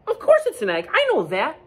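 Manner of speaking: high-pitched, indignant duck voice; huffy duck. Acted. Duck answering Goose's obvious remark, huffy. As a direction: huff it high, like an offended duck. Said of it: "huffed Duck".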